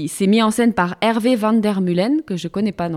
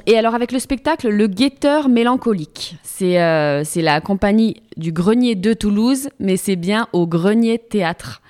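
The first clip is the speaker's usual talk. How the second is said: usual speaking voice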